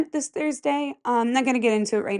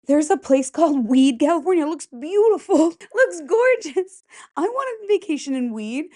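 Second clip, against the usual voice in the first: excited; 7 semitones higher than usual. Real, not acted. Delighted by Weed, California.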